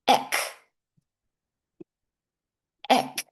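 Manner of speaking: "Ek" cut off short, hard k; isolated syllable. Shown as a demonstration. Teacher drills the first syllable "Ek". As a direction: sound out "Ek" alone. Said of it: "Repeat after me".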